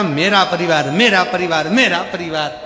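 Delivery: mock the man's own cry back at him; mocking repeat. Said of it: "क्या कहता था".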